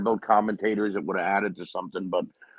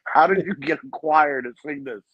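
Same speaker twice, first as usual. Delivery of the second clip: higher-pitched mock yell; half-raised yell, pitched up, quoting an outburst; retold yelling; 6 semitones higher, 3 dB louder than usual. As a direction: imitate someone yelling, without going full volume. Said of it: "When he was yelling".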